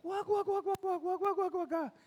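very high mock cackle; crazy laughter. Husband imitates wife laughing like crazy, mock high cackle. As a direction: imitate a crazed laugh in rapid high squawks. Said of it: "riéndose como una loca".

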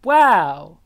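loud, sarcastic wow; mock wow. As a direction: fake amazement at nothing. Mock reaction to missing video.

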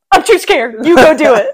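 high, loud mock panic; mock scared. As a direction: pretend to be too scared to go. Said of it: "Trent's like".

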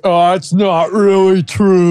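slow, drawn-out drunk impression; mock drunk. Friend imitating the streamer trolling on stream, mock drunk. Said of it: "acting like I'm drunk".

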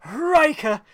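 high, loud exclamation; sudden loud excited call; excited shout. Retold or imitated. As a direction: shout the name like a battle cry. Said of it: "immediately he just goes".

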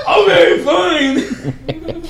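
loud, high-pitched whiny concession; mock huffy surrender. Drunk woman told to get out, mock huffy. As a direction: give in with hammed-up indignation. Imitates woman giving in angrily.

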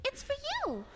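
very high, tiny duckling voice; sweet duckling. Acted. Duckling gives pigeon the cookie, very high. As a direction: give it gently as a little duckling. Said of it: "the duckling goes".

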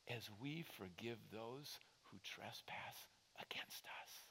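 breathy hushed whisper trailing off; soft whispered close of the prayer. Retold or imitated. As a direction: let the prayer fade into a whisper. Whispered end of retold prayer.

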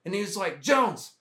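mock the old sergeant major confronting the platoon. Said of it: "he's like".

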